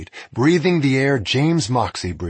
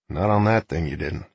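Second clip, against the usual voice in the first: lower, gruff outlaw voice; stern outlaw; 4 semitones lower than usual. Acted. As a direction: drop low and dry. Outlaw speaks flatly.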